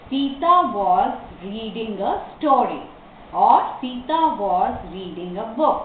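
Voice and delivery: slow, careful example sentences; model reading. Shown as a demonstration. Teacher gives the class two possible answers, slow reading. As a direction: read it out slowly for learners.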